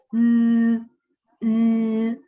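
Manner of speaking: loud, drawn-out "L" hum; sustained voiced L. Shown as a demonstration. Teacher showing that L vibrates in the throat, sustained voiced L. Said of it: "ya nos vibra esta garganta".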